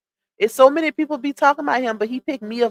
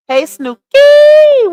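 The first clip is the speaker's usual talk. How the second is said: high, loud, drawn-out greeting; excited calling out; 11 dB louder, much slower than usual. Real, not acted. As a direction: stretch the name into a high holler.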